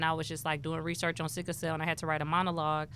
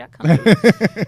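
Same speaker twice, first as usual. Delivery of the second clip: slow, much louder drawn-out promise; cocky agreement; 17 dB louder, much slower than usual. Retold or imitated. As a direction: swagger into it. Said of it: "I was just like".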